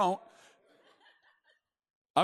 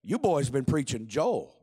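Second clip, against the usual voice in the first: Bob Jones; 6 semitones lower, slower than usual. Acted. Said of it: "And he goes".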